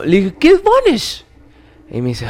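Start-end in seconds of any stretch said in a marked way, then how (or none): 0.4-1.2 way higher, puzzled squeak; surprised. Retold or imitated. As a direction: ask like you've never heard of it. Pregunta sorprendido, muy agudo. (pregunta sorprendida y muy aguda)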